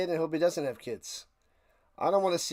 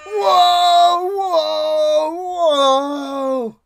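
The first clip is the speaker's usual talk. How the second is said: high, loud drawn-out whoa, fading down; loud whoa cry; 8 semitones higher, 8 dB louder, much slower than usual. Real, not acted. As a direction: cry out whoa three times, dropping off. Exclaiming at something on screen.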